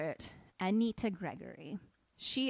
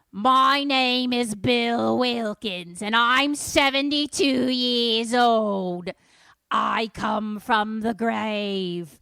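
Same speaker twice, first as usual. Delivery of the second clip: very loud, drawn-out demon roar; hammed-up possessed-child voice; demonic possession voice; 3 semitones higher, 13 dB louder, much slower than usual. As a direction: bellow slowly like a territorial ghost. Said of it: "it has this voice".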